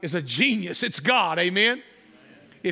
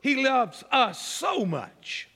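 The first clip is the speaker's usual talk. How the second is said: slow, higher delivery with "so" stretched out; emphatic SO; 4 semitones higher, slower than usual. Shown as a demonstration. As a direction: draw out "so" to show its emphasis. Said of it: "It's an, an emphatic word".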